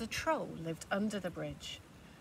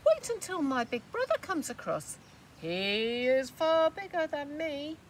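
pleading goat; 7 semitones higher, 7 dB louder than usual. Acted.

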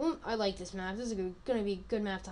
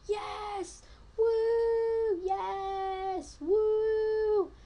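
high, loud victory whoops; excited cheering; 11 semitones higher, 4 dB louder, much slower than usual. Real, not acted. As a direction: cheer like you just won. Wins round, cheering.

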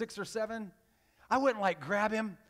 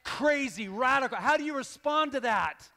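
ordinary talk, nothing marked

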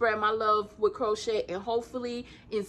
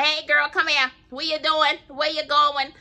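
louder, higher down-home auntie calling out; old school auntie; 5 semitones higher, 5 dB louder than usual. Retold or imitated. Imitating her aunties back home, lightly hammed-up call. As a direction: hail her like a country auntie on the porch. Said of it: "old school aunties back home that'd be like".

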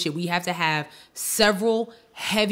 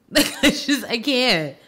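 exasperated laugh; 5 semitones higher, 4 dB louder, slower than usual. Real, not acted. Exasperated laugh closing heavy topic.

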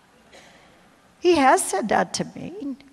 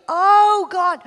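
sobbing cry; 8 semitones higher, 9 dB louder than usual. Retold or imitated. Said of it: "And I'm sobbing".

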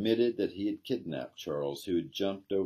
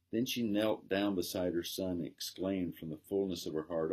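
plain reading voice

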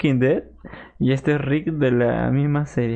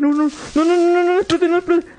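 high, loud panicked cry; pánico; 5 dB louder than usual. Real, not acted. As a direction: yell no as the creeper blows up. Creeper about to explode. (grito agudo de pánico ante el creeper)

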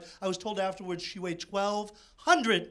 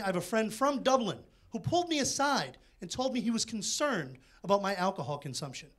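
ordinary talk, nothing marked

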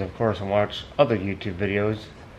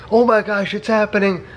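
excited; 9 semitones higher than usual. Retold or imitated. Mock excited reaction to post.

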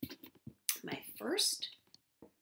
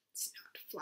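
whisper; 11 dB quieter than usual. Acted. Aside in story.

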